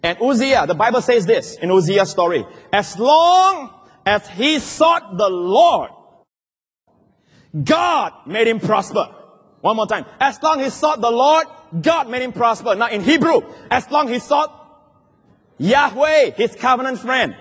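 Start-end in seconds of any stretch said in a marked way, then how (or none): 2.7-9.1 very slow, stretched pulpit reading; grand, lingering scripture delivery; drawn-out proclamation. Real, not acted. 10.2-17.3 high, ringing scripture proclamation; raised, punchy pulpit delivery; emphatic preaching. Real, not acted. Preaches at full force.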